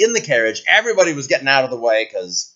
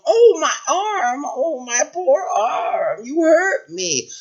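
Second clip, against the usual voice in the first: put-on high, slow, pained old-woman voice; hammed-up cry of pain; wailing old lady; 12 semitones higher, slower than usual. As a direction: wail pitifully over an injury.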